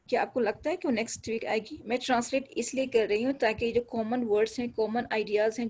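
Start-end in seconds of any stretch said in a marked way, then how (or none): none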